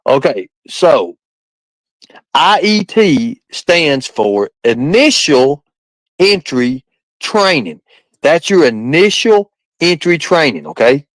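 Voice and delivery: slow, deliberate explanation; slow explaining. Shown as a demonstration. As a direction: spell it out slowly. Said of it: "break all this down".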